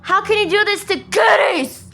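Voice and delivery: high, loud, anguished protest; outraged yell. Real, not acted. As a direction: yell it at the one who hurt the kittens. Outrage at the cat killing.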